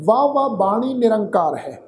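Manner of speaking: ਵਾਹ ਵਾਹ as "vaa vaa", long open vowels; ਵਾਹ said twice as one long syllable; correct pronunciation. Shown as a demonstration. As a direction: recite ਵਾਹ ਵਾਹ with no "ho" endings. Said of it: "ਵਾਹੋ ਵਾਹੋ ਬਾਣੀ ਨਿਰੰਕਾਰ ਨਹੀਂ ਪੜ੍ਹਨਾ".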